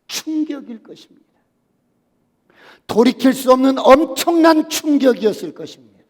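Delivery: full-force pulpit preaching; fiery preaching. Acted. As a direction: preach it out to the congregation.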